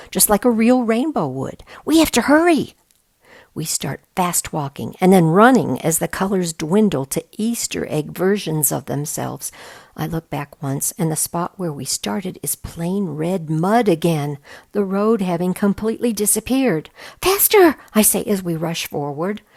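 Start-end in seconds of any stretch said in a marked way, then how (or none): 0.1-2.7 higher and louder, rising alarm; louder and higher with urgency; urgent. A narrator's reading. Urgent, road vanishing. 17.2-17.8 high loud shout; loud urgent cry of faster. A narrator's reading. Running as road fades.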